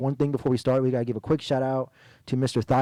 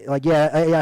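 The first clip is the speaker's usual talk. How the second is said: normal talking voice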